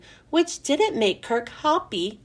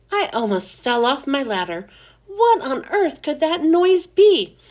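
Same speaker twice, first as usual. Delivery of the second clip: ordinary delivery, nothing marked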